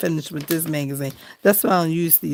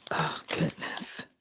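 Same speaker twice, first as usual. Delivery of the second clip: breathless sigh; 11 dB quieter, slower than usual. Real, not acted. Sighs at the magazine.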